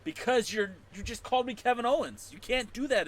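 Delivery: high playful scold; mock scolding. Real, not acted. Streamer scolding a viewer, mock scolding. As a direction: point out the offence gleefully.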